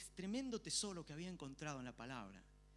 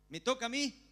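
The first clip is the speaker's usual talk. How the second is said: high and loud, hopeful; eager question; 8 semitones higher, 10 dB louder than usual. Retold or imitated. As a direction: ask as if your turn finally came. Retells waiting for his turn. (pregunta ansiosa, aguda y fuerte)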